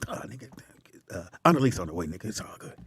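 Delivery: unvoiced hushed reassurance; whispered. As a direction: whisper it sheepishly.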